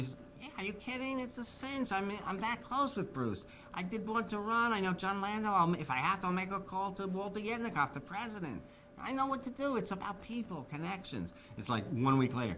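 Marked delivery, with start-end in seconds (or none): 0.4-11.3 much higher mock voice, cocky; Jimmy assuring them the song is a cinch; imitating Jimmy boasting. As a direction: brag about your connections in a light impression.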